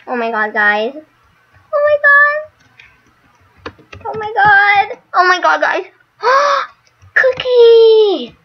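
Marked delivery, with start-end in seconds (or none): 1.7-8.4 much higher, louder squeals of pure joy; overjoyed shriek. Real, not acted. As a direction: shriek it out as the pet finally hatches. Pet hatches, screaming with joy.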